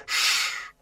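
soft, drawn-out "shh"; hushing shush. A narrator's reading. Grey Brother silences Mowgli, with a soft hush. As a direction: shush him quietly.

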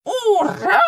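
joyful shout. A narrator's reading. Lesyk cheering in his sleep, a high shouted hooray. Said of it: "і Лесик з усіма".

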